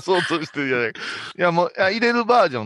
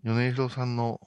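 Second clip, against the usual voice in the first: languid office lady; 2 semitones lower, 6 dB quieter than usual. Shown as a demonstration. Director sketches an OL monologue for the actress, languid. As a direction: think aloud wearily, with long pauses. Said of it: "ちょっと気だるさだけでいいね".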